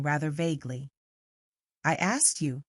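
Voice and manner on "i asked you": plain reading voice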